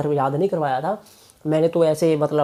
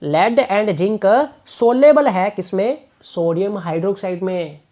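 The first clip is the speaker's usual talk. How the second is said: very slow, stretching each word; drawn-out quiz question; slower than usual. Shown as a demonstration. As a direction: quiz the class slowly, word by word. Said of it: "भूलना मत". (बहुत धीरे, हर शब्द खींचकर पूछना)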